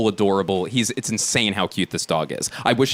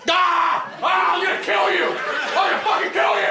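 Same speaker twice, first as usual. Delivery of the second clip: very high-pitched screamed threat; crazed threat. Acted. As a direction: scream it like a madman on the attack. Imitates deranged man charging at him.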